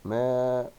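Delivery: "ماء" drawn out as "maaa-un"; tanween damma ending. Shown as a demonstration. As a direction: sound out ماء with a clear -un. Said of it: "ضمتين".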